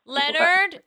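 high, loud call of Leonard; playful shouted name. Shown as a demonstration. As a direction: yell the name across the room. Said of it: "start yelling somebody's name".

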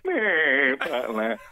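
long groaned "eh", then "butler" dropping away; comic drawn-out "eh"; Blakey. Retold or imitated. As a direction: mimic a sitcom busman's catchphrase. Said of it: "a character on there called Blakey".

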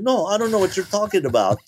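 fast and laughing; laughing denial. Retold or imitated. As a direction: brush it off with a laugh. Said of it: "And he said".

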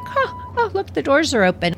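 high, quick, delighted dog voice; playful pup spotting the elevator; curious dog voice. Pretend. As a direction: voice the dog discovering open doors. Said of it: "Tony Dog's like".

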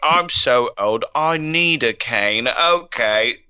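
hammed-up old Cockney actor telling a joke; drawn-out put-on British voice; Michael Caine. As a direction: play a British film star doing bad stand-up. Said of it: "oh, Michael Caine, here's a joke".

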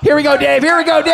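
excited cheering. Real, not acted. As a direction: cheer your man on as the match starts. Cheering on arm wrestler.

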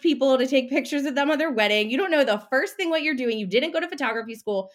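the doubting brain mocking a would-be photographer; nagging inner critic. Brain scolds the nurse turned photographer, scornful and pushy.

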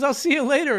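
high squeaky goodbye; breezy high stooge voice; Curly voice. Retold or imitated. Voicing Belushi doing Curly.

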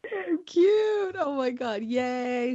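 high squealed gush; real delight. Real, not acted. Host gushing over the story's pictures, high and excited.

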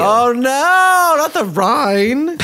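high, laughing mock horror; clutching pearls, laughing. Mock horror at cheese joke.

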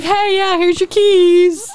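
high, drawn-out singsong; imitated breezy greeting, slow and high; mock cheery handover. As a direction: stretch it out high in a teasing imitation. Retelling friends handing back keys.

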